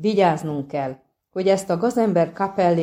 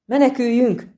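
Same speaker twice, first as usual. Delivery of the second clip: high, loud cry to run away; 6 semitones higher, 4 dB louder than usual. A narrator's reading. Poet crying out to his friends to run, loud and high. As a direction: yell at the others to flee. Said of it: "kiáltott fel Kökörcsin".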